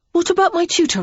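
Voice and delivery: put-on an eager boy blurting a question; boy Henry. A narrator's reading. Young Henry asking his brother, high and eager.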